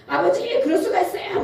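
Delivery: high-pitched mock protest with a laugh; arguing with God. Pastor acting out arguing with God in prayer, high protest. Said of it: "막 따지고 막 그래야 되는데".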